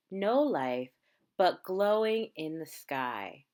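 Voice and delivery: normal talking voice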